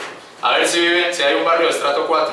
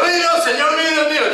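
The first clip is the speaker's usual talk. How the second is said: high, awestruck exclamation of Tomás; the faltering, amazed disciple blurting it out high; awed cry; 9 semitones higher than usual. Retold or imitated. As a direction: gasp it out, overwhelmed before the Lord. Tomás's overwhelmed cry retold.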